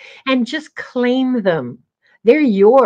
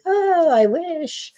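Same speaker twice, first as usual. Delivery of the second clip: high, slow, dismissive sigh; offhand wish; 8 semitones higher, much slower than usual. Shown as a demonstration. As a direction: toss off the wish lightly. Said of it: "It's not just sort of like".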